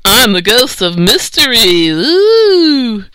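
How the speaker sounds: slow spooky ghost voice rising to a high "woo"; hammed-up haunting, drawn out; mock wailing ghost. Tarby playing a ghost to tease Lewis, mock wail. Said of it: "he wailed".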